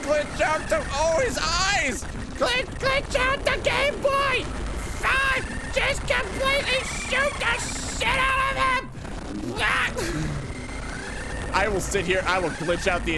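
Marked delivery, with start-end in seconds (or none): 0.0-11.5 panicked shouting. Real, not acted. Gamer panicking mid boss fight, yelling high and frantic. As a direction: scream orders in a panic.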